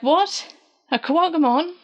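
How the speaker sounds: high, questioning mock surprise; surprised. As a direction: imitate someone taken aback. Retold surprise at the attacker.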